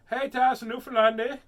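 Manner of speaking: loud, high Newfoundland greeting; attempted Newfoundland accent, big and high. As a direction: holler it in a playful Newfoundland accent. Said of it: "Is that a Newfoundland accent?".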